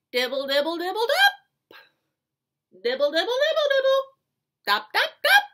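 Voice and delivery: high, rapid pattering syllables; bouncy imitated raindrops; rain sounds. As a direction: patter like rain, rising into loud drops. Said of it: "He can sound like the rain".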